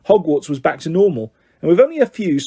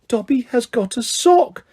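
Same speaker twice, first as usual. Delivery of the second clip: high, squeaky elf voice, stunned; an elf who can't believe his luck; Dobby in disbelief; 8 semitones higher than usual. A narrator's reading. House-elf staring at his sock in disbelief. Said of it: "said Dobby in disbelief".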